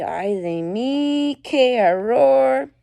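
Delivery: slow, hammed-up Spanish lament; mock dismay. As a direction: wail it in mock horror, drawn out.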